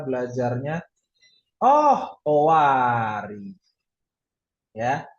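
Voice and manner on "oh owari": mock surprise. Teacher announcing the lesson is over, mock surprise. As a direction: leap up on oh, stretch owari out slowly.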